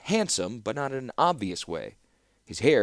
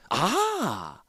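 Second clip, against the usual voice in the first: higher, drawn-out emphatic ah; 9 semitones higher than usual. A narrator's reading. Clockmaker marvelling at the music box, emphatic ah. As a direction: repeat it bigger for effect. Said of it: "then repeated for emphasis".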